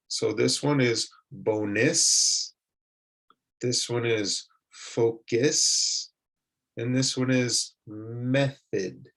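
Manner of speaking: bonus, focus, method said slowly with a reduced last vowel; slow model words. Shown as a demonstration. Teacher reads word list slowly for learners. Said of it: "the sound that they make is like the English I".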